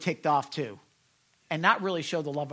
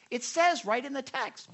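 high-pitched, worked-up insistence; exasperated; 7 semitones higher than usual. Real, not acted. Preacher worked up over the critics, exasperated. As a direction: insist on the obvious. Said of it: "don't get me started".

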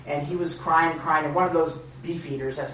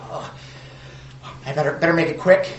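hurried nervous; 5 dB louder, faster than usual. Acted. Hurried visitor before important person.

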